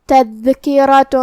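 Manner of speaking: "تذکرۃ" slow, with the tanween nun hidden into a nasal ghunna; "تذکرۃ" with ikhfa, the n half-sounded through the nose; hidden nunation. Shown as a demonstration. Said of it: "تنوین کو چھپاتے ہوئے اور غنہ کے ساتھ".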